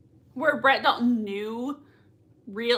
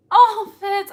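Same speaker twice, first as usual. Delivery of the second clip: fond cry; 8 semitones higher, 9 dB louder than usual. Real, not acted. Fan crying out at character.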